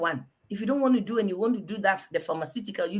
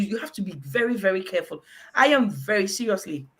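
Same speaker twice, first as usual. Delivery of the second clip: ordinary delivery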